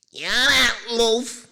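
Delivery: put-on bellowed high and loud in a grumpy Manc accent; a mancunian yell across the station, mock bad-tempered; ill-tempered Manc accent. Calling to best friend across Euston Station, loud grumpy Manc accent. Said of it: "in this ill-tempered Manc accent".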